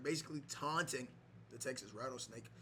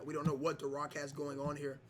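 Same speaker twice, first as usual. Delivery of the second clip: ordinary talk, nothing marked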